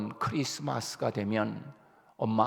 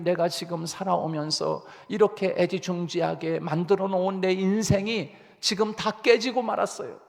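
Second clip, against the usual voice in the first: tearful prayer; 6 semitones higher than usual. Retold or imitated. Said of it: "우리가 눈물로".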